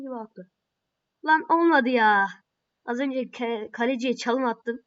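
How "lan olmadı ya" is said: loud annoyed outburst; loud exasperated yell; frustrated shout. Real, not acted. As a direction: bark it at the screen in disbelief. Frustrated at a miss.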